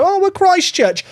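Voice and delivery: high mocking voice of rival fans; lightly voiced rival fan, high and mocking. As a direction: sneer it as the other team. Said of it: "and go".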